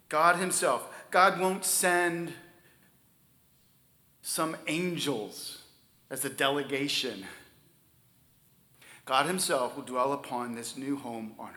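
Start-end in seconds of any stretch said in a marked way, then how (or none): 0.1-0.9 "God himself" hit hard and louder; stress on God himself. Shown as a demonstration. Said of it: "The words are emphatic". 1.1-7.3 slow, deliberate words; slow emphasis. Real, not acted. Slowed for emphasis.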